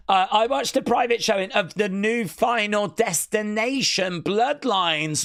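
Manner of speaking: ordinary talk, nothing marked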